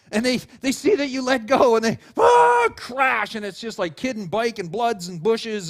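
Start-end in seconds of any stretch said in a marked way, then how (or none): 2.2-2.7 high, loud mock scream; sudden yelp of alarm; panicked scream. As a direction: imitate a kid screaming before the crash. Child sees parent let go.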